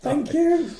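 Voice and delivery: high put-on voice, prim and polite; mock patient voice. Patient explaining to the doctor he only wants drilling, high put-on voice. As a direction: play a fussy patient booking a skull drill.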